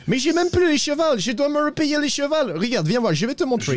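high-pitched, worked-up protest; agitated complaint. Acted. Broke rider explaining he must buy horses again, agitated.

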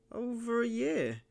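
put-on suspicious old miser in a high, cracked voice; high, croaking old miser. A narrator's reading. Narrator voicing the miser answering the stranger, high and wary. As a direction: pitch it way up like a wary old hermit.

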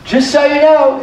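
high-pitched, hesitant heads-up; piping up. Retold or imitated. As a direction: pipe up with an awkward warning. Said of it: "Martha pipes back up".

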